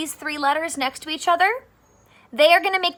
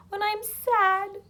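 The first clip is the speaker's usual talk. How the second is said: pretend crying; 8 semitones higher than usual. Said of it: "I was just pretending to cry".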